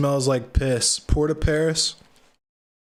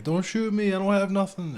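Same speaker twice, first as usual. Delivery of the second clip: pleading; 9 semitones higher than usual. Retold or imitated. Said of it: "being like".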